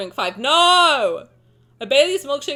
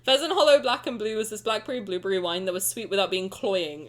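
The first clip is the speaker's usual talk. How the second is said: normal talking voice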